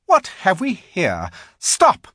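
high, loud commanding witch. A narrator's reading. Witch halting her sledge at the feast. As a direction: bark the order to halt like a cold queen. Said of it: "at last the witch said".

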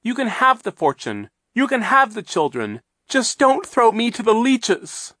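high, loud desperate begging; desperate pleading. Acted. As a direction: beg for your life in panic. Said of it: "Aunt Josephine said desperately".

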